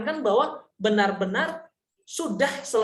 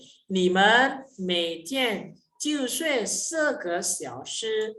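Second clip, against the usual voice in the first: slow, deliberate example sentence; slow demo reading; much slower than usual. Shown as a demonstration. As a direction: lay out every syllable slowly. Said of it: "Berbeda hanya pada penekanan".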